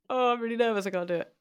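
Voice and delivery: mock nervous friend. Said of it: "So it'd be like".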